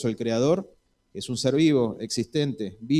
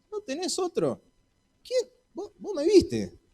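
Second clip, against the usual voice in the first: whiny Moses. Pretend. Moses whining protest to God.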